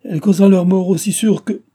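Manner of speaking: put-on an old lady ranting in outrage; outraged declaiming. A narrator's reading. Old grandmother ranting to her daughter-in-law about the governess, outraged. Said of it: "la vieille dame déclamer contre moi".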